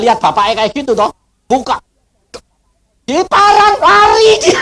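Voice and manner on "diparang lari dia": high, excited comic shout; retold panicked cry. Preacher tells of son fleeing a machete, high comic cry.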